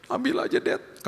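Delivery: put-on high, whispered little child. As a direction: give it up in a tiny whisper.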